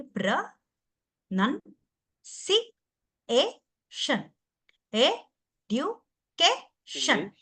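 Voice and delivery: ప్ర-నం-సి-ఏ-షన్ split slowly into separate syllables, stress before షన్. Shown as a demonstration. Teacher showing the online class how to break words into syllables, very slowly. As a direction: slowly chop ఎడ్యుకేషన్ into its syllables, leaning on కే. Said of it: "ఇప్పుడు ప్రొనౌన్సియేషన్ చూడండి".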